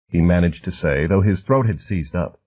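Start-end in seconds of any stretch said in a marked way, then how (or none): none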